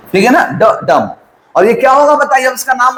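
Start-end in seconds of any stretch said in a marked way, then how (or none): 0.5-1.1 quick light "ड" into stressed "डम"; "ड" unstressed, "डम" stressed and dropping in pitch; unstressed-stressed beat. Shown as a demonstration. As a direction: tap out the iamb, weak "ड", strong "डम". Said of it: "unstressed, stressed है".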